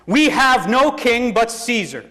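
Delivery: high, slow, forceful declaration; defiant cry. A narrator's reading. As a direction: call it back defiantly as a crowd. Priests shout back at Pilate.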